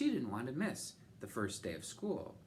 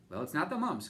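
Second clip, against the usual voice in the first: concluding specialist; 6 semitones higher, 7 dB louder than usual. A narrator's reading. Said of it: "concluded Doctor Grupp".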